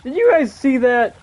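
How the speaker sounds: high, loud astonished shout; shocked disbelief; surprised. Real, not acted. Surprise during game.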